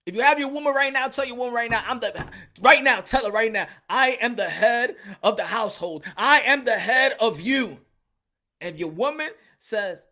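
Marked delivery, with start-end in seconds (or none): 0.0-7.8 loud, higher rallying call; rally the brothers, full voice; a loud, higher sermon-like order; preaching loud. Real, not acted. Preaching command to men.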